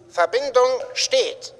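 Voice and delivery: ordinary talk, nothing marked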